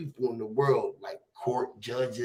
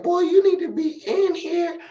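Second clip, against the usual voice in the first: a hint of the principal, pitched higher; imitating the principal; 4 dB louder than usual. Principal telling him the boy is trouble, lightly voiced higher. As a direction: lightly voice her, higher and pressing. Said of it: "She said".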